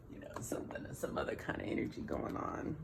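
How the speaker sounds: hushed whispered aside. Real, not acted. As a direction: whisper it under your breath.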